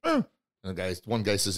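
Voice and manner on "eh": short nasal whine like a dog; imitated dog whimper.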